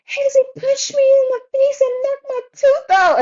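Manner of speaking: put-on high, loud wailing kid voice; upset daughter. Daughter tattling to mom about her brother, high and loud. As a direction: squeal it like a hurt little girl complaining. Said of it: "she's like".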